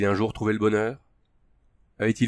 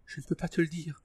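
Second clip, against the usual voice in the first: higher, soft sighing refusal; frightened woman sighing no; sighed; 7 semitones higher, 8 dB quieter than usual. A narrator's reading. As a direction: sigh it out, higher and hushed. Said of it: "soupira-t-elle".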